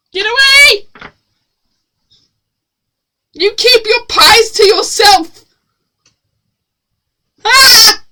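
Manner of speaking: high loud shout; yelling get away. Real, not acted. Shouting at chat to keep pies away. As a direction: shout them off.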